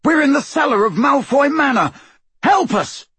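high, frantic yell for help; desperate yell. A narrator's reading. Trapped boy pleading into a mirror, desperate high yell. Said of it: "he yelled at it in mad desperation".

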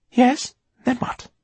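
high-pitched, impatient prompt; insistent questioning. A narrator's reading. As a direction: press for the answer in a high voice. Eleanor impatiently questions Darius.

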